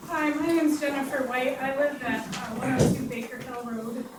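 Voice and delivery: hoarse, strained voice going out; losing her voice. Real, not acted. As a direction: push through a voice that's nearly gone. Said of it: "I'm losing my voice here".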